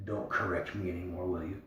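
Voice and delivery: a hint of chill under politeness; faint rebuke. A narrator's reading. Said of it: "faintest suggestion of".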